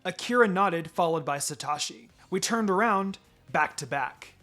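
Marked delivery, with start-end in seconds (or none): none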